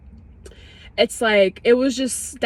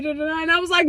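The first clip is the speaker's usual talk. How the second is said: ordinary talk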